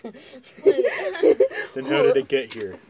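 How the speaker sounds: slow, laughing dad; puzzled father, drawn out. Acted. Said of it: "my dad said".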